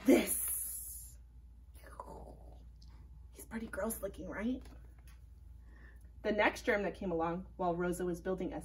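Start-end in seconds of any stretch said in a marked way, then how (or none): 0.1-1.1 whispered this; breathy reveal. Acted. Revealing the germ picture. 3.3-4.6 soft whispered aside; whispering. Acted. Aside to child listener.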